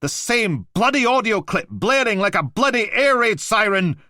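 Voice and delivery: high, loud angry yell; furious shout. Acted. Blakey shouting at the Mantis about the noise, furious. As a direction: tear into him.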